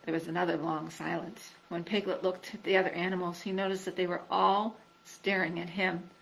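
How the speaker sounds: usual speaking voice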